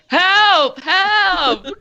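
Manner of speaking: high, loud cry for help; Arnadel crying for help. Acted. Game master relays a cry through the messenger dragon, high shout. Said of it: "you just hear Arnadel's voice".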